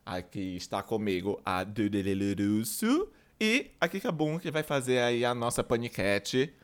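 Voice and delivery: ordinary talk, nothing marked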